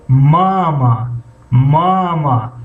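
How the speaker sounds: ماما with the first ma lengthened, slow; slow teacher demo of ماما, first syllable long; first syllable drawn out. Shown as a demonstration. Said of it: "هجای یکم از هجای دوم کمی بلندتر".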